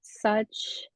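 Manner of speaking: whispered breath as tears come; choked up. Real, not acted. Survivor to host, close to tears, whispering. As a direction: voice catching on the edge of tears. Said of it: "I'm honestly gonna te-, tear up".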